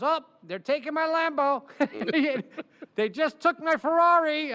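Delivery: high, loud mock wail over the cars; a rich client complaining that agents are taking his cars; whining rich client. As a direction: whine about losing your Ferrari. Client whining about seized cars.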